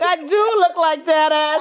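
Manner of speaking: high, slow whiny mock daughter; daughter's voice. Daughter complaining about her new look. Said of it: "She's like".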